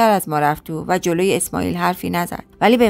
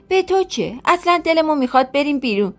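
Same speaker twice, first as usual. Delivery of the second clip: higher, defiant scolding; mildly raised sister's anger; defiant retort; 6 semitones higher than usual. Acted. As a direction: tell him off through the door.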